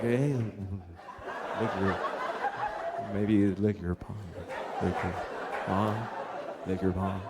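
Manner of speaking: low, soft, halting mumble; hesitant mumble. Retold or imitated. Husband timidly asking wife in bed, low halting mumble. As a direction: mumble it shyly, trailing off. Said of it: "I go like".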